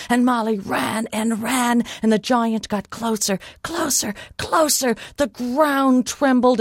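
high, urgent chase narration; breathless suspense. A narrator's reading. Giant gains on fleeing Molly, urgent high suspense.

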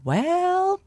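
high, drawn-out coy opener; teasing. Acted. Molly answers the raging giant, coy teasing. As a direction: tease with a high, lingering word. Molly's cheeky parting reply.

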